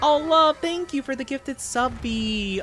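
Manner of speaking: high delighted squeal of thanks; excited thanks. Real, not acted. Streamer squealing thanks to a viewer for a gifted sub. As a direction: gush over the gift.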